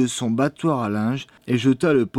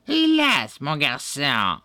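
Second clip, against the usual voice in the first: high wail on hélas, dropping after; extra-sugary crone; 4 semitones higher, slower than usual. A narrator's reading. Old woman pleads with the boy, sugary witch voice. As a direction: lament it with false sweetness. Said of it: "plus sucrée que jamais".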